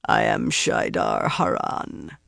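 low, slow inhuman creature voice; menacing Myrddraal. A narrator's reading. Myrddraal naming itself to the kneeling Grendal, menacing. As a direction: announce yourself slowly, deep and menacing.

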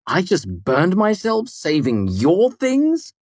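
slow, high-pitched indignant disbelief; feigned dismay. A narrator's reading. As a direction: act appalled at your own good deed. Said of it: "proper amount of dismay in my tone".

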